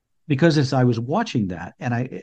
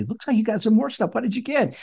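put-on much higher, playful kid-directed voice; dad talking to toddler; 10 semitones higher than usual. Dad at Christmas asking his little boy, playful. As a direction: lift it high for a small child. Father asks small son about gifts.

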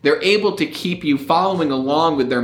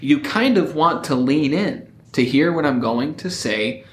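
usual speaking voice

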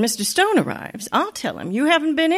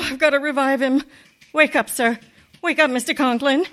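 ordinary talk, nothing marked

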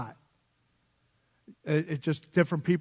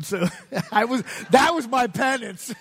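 laughing; 6 semitones higher than usual. Real, not acted. Laughing about his tattoo.

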